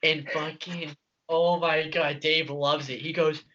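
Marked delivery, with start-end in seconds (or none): none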